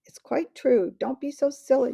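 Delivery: shivering cold, teeth chattering. A narrator's reading. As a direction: speak through chattering teeth, freezing. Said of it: "teeth were now chattering with the cold".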